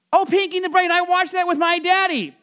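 high-pitched imitation of an excited child; little camper. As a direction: pipe up like a little kid at camp. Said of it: "one of the little campers was like".

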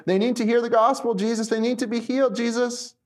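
urgent disciples. Acted. Disciples pressing Jesus about the crowds, urgent appeal. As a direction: press the need on him. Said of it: "come to him and be like".

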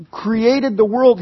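ordinary talk, nothing marked

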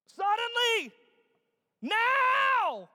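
high, loud drawn-out yells; yelled exclamations. Acted. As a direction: shout every exclamation point. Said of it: "willing to yell it".

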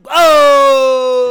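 very loud, high, drawn-out "oh"; long scandalized shout; shocked objection. Shown as a demonstration. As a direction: howl in mock outrage. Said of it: "be like".

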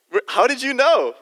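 high, loud surprised burst; how-could-you-know yelp; mock astonishment. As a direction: call out in playful amazement.

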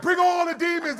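high, loud laughing shout; excited crowd shout. Retold or imitated. Preacher retelling the India village crowd, laughing shout. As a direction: yell it like you're on steroids, laughing. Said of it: "everybody was like".

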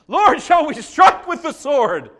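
loud, slow, high shout; eager disciple shouting. Retold or imitated. Disciples asking Jesus whether to fight, slow loud shout. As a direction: call out, itching to fight. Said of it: "Hear your foolish [name]".

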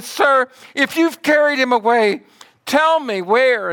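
loud, high, desperate plea; tearful pleading. Acted. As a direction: beg urgently as weeping Mary, without sobs. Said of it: "she's weeping and crying".

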